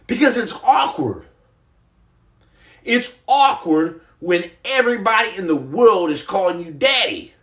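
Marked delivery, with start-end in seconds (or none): none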